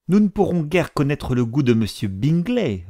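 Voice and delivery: sulky retort, voice pushed up; avec humeur. A narrator's reading. A mother grumbles at her husband, peevishly. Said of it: "répondit avec humeur madame Bennet".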